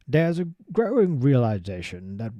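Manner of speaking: attempted posh English accent, a bit put-on; a try at a British royal accent; Prince Charles accent. Reading the Prince's speech aloud in a posh English accent. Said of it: "I'll try and do a Prince Charles accent".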